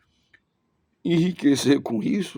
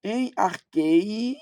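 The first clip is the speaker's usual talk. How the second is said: "Em arquei" very slowly, ar-QUE-I; "Em arquei" with a long, stressed "ei"; stress on final syllable; 2 semitones higher, much slower than usual. Shown as a demonstration. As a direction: stretch the ending of "arquei" for learners. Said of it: "Aqui é a sílaba tônica".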